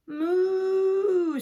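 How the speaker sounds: imitation of a cow; cow moo. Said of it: "said the cow".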